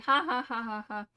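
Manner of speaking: spoken ha ha ha, taunting; mock laugh. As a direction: laugh it out like a cocky kid who got away. Kid laughs at cops mid-flip.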